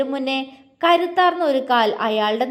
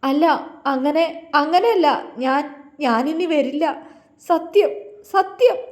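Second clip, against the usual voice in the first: crying plea; 3 semitones higher than usual. A narrator's reading. Villain crying to the hero, swearing he'll never return. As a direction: swear it, weeping and rising. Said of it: "അയാൾ കരഞ്ഞുകൊണ്ട് പുറകിലേക്ക് നീങ്ങിപ്പോയി".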